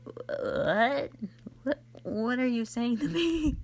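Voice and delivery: ordinary talk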